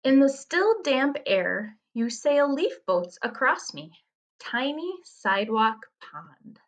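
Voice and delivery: poem reading. Acted. Teacher reads a puddle riddle to students, poem reading. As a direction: linger on the first and last lines.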